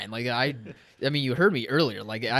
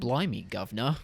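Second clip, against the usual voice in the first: mock British; 3 semitones lower than usual. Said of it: "you're throwing it on right now".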